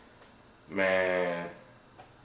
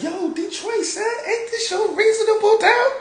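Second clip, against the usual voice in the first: falsetto cry of discovery, getting loud; high-pitched imitation; 8 dB louder than usual. Homeboy spotting the stolen album, high-pitched. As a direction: imitate him way up high, building louder. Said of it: "high pitch".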